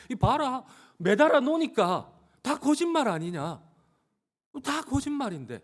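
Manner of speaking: high, scoffing accusation; accusing. Acted. Accusers gloating that the crucified man lied, accusing.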